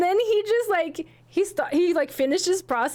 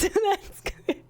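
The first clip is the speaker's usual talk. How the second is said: laughing; 7 semitones higher than usual. Retold or imitated. Giggling at own proposal.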